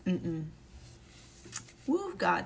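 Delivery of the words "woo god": "Woo" jumps high; a sudden burst of praise; joyful woo. Real, not acted. Mom thanking God on camera, excited.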